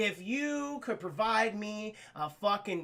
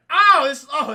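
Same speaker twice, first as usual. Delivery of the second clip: delighted oh; 8 semitones higher, 16 dB louder, slower than usual. Retold or imitated. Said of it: "he's like".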